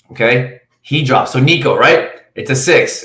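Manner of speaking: ordinary delivery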